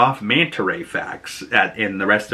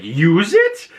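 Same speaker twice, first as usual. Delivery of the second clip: John surprised; 8 semitones higher, 4 dB louder than usual. Retold or imitated. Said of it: "And John's like".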